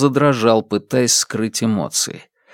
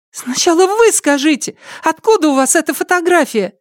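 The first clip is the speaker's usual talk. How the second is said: put-on indignant girl, pitched way up; crying out. A narrator's reading. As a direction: fire the demand back in a high voice. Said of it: "выкрикнула Лю Хэйян ему в лицо".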